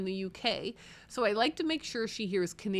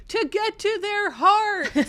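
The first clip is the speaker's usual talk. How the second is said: loud, slow and much higher; read out in all caps; 11 semitones higher, 7 dB louder, slower than usual. Acted. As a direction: shout it like a comment typed in caps. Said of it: "says, in all caps".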